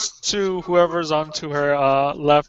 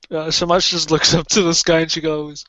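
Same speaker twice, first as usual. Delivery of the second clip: usual speaking voice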